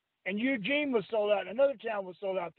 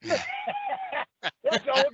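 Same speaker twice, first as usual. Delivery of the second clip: laughing; much slower than usual. Real, not acted. Laughing mid-insult to promoter.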